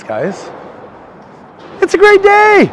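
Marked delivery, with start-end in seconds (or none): 1.8-2.7 sung out much higher and louder; joyful proclamation; excited. Real, not acted. Vlogger announcing to viewers, high loud joyful call. Upbeat exclamation on the walk.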